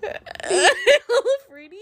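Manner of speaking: high, slow, giggling through the words; laughing. Real, not acted. Finishing a joke about Harry, laughing. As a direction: squeal it out through helpless giggles.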